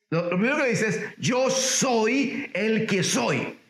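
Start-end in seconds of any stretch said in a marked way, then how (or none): 1.2-3.6 drawn-out majestic declaration; slow and weighty, as God; slow solemn divine voice. Retold or imitated. God answering Moses, slow and solemn.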